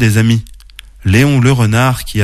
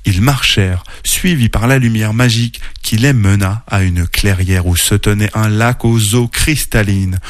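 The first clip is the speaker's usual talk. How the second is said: plain reading voice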